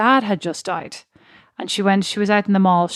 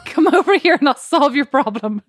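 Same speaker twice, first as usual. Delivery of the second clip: higher, said through laughter; laughing mock offer; 5 semitones higher, 4 dB louder than usual. Joking about approaching a crying stranger on the bus, laughing. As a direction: crack up while pretending to order someone over.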